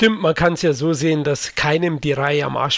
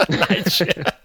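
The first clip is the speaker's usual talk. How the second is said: laughing; 6 semitones higher than usual. Real, not acted. Laughs after teasing joke.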